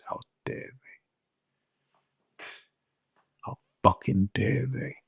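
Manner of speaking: fierce, hushed whisper of outrage. Real, not acted. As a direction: hiss the anger out under your breath. Outraged aside about demons.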